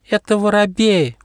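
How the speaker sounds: high, assured butterfly voice; sure-of-herself butterfly; confident. Acted. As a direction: declare it with certainty, pitched high. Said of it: "уверенно заявила Уэлли".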